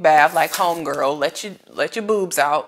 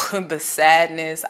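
ordinary talk, nothing marked

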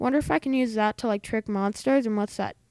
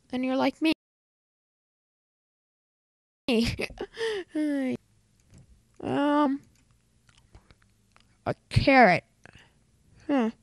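talking while chewing gum; 2 semitones higher than usual. Real, not acted. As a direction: gum in the mouth. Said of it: "I have gum in my mouth".

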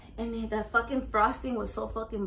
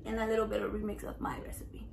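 unvoiced secretive aside; whisper; 2 semitones lower, 7 dB quieter, faster than usual. Telling viewers the recipe is her mom's, whispered.

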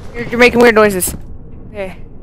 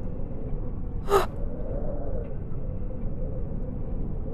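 terrified gasp; 8 semitones higher, 17 dB quieter than usual. Real, not acted. Terrified breath after attack.

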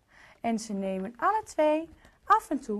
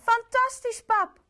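high, loud delighted cry; 11 semitones higher, 5 dB louder than usual. Acted. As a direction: burst out with joy. Lot delighted by cookies.

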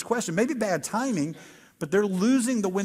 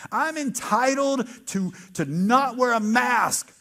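mock entitled; 5 semitones higher, 4 dB louder, slower than usual. Mocking entitled anti-mask voice.

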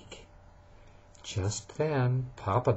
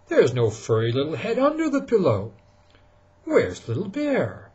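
Papa Bear searching; 5 semitones higher, 3 dB louder than usual. Acted. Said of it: "said Papa Bear".

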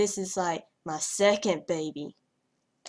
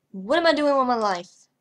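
higher, louder exasperated cry; mock despair; 4 semitones higher, 8 dB louder than usual. Real, not acted. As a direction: throw up your hands. Exasperated at herself.

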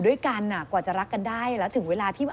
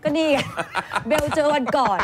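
said through laughter, higher and louder; laughing; 5 semitones higher, 3 dB louder than usual. Real, not acted. Host teases cohost, repeating she met Bel, laughing. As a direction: laugh while repeating เบลเจอวันก่อน.

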